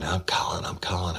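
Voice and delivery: hushed, breathless whisper of a threat; grandmother's threat. Retold or imitated. Woman retelling grandmother's threat to call, whispered. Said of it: "she kept saying".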